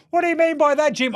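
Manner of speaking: high-pitched questioning listener; imagined questioner. Acted. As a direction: ask it high, as an imagined listener challenging the host. Imagined listener asks again.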